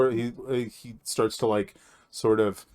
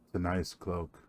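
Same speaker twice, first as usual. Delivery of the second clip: low, soft, intimate murmur; creepy quiet voice; 4 semitones lower, 9 dB quieter than usual. Acted. Strange elf admires the player's cloak in a low, soft voice. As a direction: creep closer with a hushed voice.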